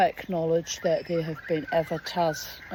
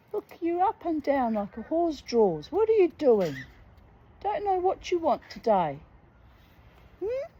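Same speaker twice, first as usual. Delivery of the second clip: talking to birds; 10 semitones higher than usual. Real, not acted.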